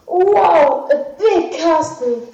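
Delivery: a lightly voiced amazed wow. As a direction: marvel at the castle. Jack amazed at castle.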